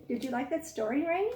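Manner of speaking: ordinary talk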